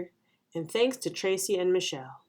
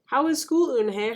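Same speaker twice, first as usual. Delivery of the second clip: high, lilting greeting; interested mother; 6 semitones higher, 4 dB louder than usual. A narrator's reading. Mother asks Eunhae as she walks in, interested. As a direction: greet a child home from school.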